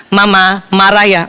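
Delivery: slow, low, measured asking; slow, careful question. Retold or imitated. Husband asking whether his wife is angry, slow and careful. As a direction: ask it unhurriedly, word by word. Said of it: "Saya suruh tanya tiga kali".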